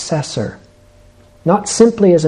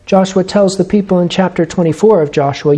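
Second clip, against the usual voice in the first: usual speaking voice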